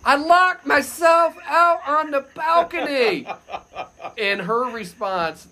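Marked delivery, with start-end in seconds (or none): 0.1-3.3 slow, high holler across a distance; loud, stretched-out call; yelling. Retold or imitated. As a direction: re-enact shouting an embarrassing plea to the street. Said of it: "I have to yell".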